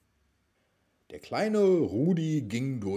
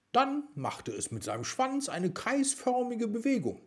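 ordinary delivery

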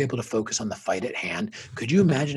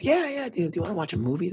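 higher, quicker light voicing of the wife; wife's voice; 8 semitones higher than usual. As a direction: play the wife, eager and brisk. Wife answering him, voiced.

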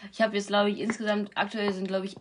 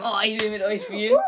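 nachgeahmter Fan; 4 dB louder than usual. Retold or imitated. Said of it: "haben Leute geschrieben".

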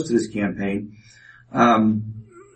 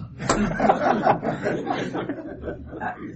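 a real breathy laugh of disbelief; amazed laugh; 9 semitones higher, 4 dB quieter than usual. Real, not acted. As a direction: laugh at how unlikely it was. Laughs at unlikely outcome.

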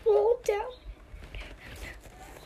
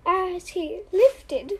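ordinary delivery, nothing marked

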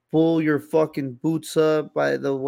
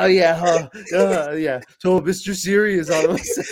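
higher, louder mocking dad voice; teasing dad; 4 semitones higher, 5 dB louder than usual. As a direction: ham up the dad's sarcasm. Son imitates teasing dad.

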